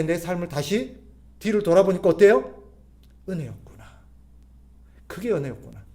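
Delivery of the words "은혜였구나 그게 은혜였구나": softer, lowered voice of realisation; hushed realisation. Acted. Looking back on hardship and seeing grace, hushed realisation. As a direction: let it sink in, softly.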